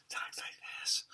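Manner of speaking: creepy, voiceless whisper; demonic dog whisper. Acted. Reviewer imitating the movie's demonic dog, creepy whisper. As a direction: whisper it like an inhuman hellhound. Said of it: "he's being like".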